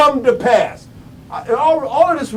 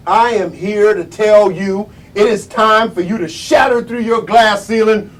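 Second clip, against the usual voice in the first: ordinary delivery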